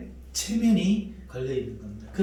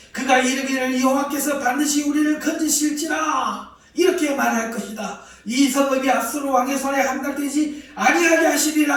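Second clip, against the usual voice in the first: much higher and louder, shouted at the crowd; loud taunting proclamation; 9 semitones higher, 7 dB louder than usual. Acted. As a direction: bellow the Assyrian king's threats from below the wall. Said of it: "이렇게 큰 소리로 말합니다".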